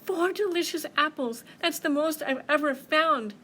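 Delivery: high, loud, excited little mouse; delighted. Acted. Mouse admiring her four apples, delighted. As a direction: exclaim over a wonderful find.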